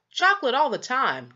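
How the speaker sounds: "Chocolate" hit hard, then falling firmly; emphatic answer. A narrator's reading. Boy insisting to his father on chocolate, emphatic. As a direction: plant your feet on "Chocolate all the time". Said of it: "John replied emphatically".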